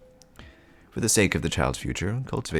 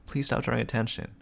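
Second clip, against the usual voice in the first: ordinary talk, nothing marked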